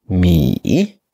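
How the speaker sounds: "Mi" dipping low, then climbing back up; drawn-out "Mi" with a low dip and rise; third tone. Shown as a demonstration. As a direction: drop "Mi" deep until the breath catches, then rise. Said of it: "Vous baissez la tête vers les graves et vous remontez".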